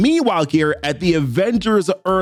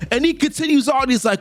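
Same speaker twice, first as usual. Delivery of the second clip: normal talking voice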